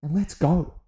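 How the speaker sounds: high hyped cheer; pumped up rallying cry; excited. Real, not acted. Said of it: "I would be so much louder".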